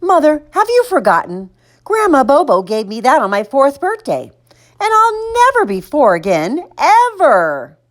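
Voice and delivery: put-on high, melodramatic little-boy protest; hammed-up wounded child; dramatic. A narrator's reading. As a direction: lay on the guilt, pitched high. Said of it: "Don't be so dramatic".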